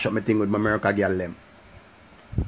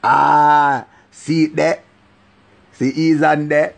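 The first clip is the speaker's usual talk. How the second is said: imitating them; 4 semitones higher, 7 dB louder, much slower than usual. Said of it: "that's something they go".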